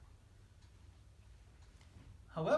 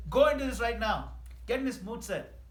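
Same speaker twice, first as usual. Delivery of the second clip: urging; 5 semitones higher, 10 dB louder than usual. Retold or imitated. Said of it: "the acharyas tell us".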